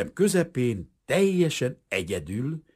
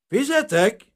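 higher, louder call for the bill; 10 semitones higher, 6 dB louder, slower than usual. A narrator's reading. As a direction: call the waiter across the room. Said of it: "Kiáltott Aronzon".

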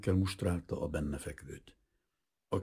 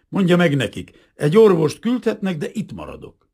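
loud, high, firm refusal; stubborn insistence; 12 semitones higher, 11 dB louder than usual. A narrator's reading. Captain refuses hospital to the officers, loud and firm. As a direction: plant your feet and refuse.

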